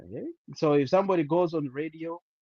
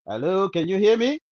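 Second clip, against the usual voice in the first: speaking up; 6 semitones higher, 13 dB louder than usual. Real, not acted. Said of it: "What if you speak up? We can't hear you".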